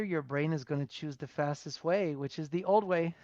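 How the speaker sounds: ordinary talk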